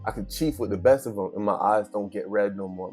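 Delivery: usual speaking voice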